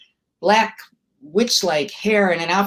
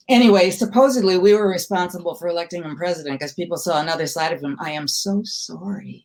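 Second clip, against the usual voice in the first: ordinary delivery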